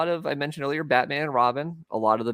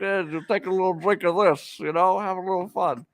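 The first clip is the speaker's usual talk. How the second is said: Cosby impression; 6 semitones higher than usual. Joking about Cosby, voicing him.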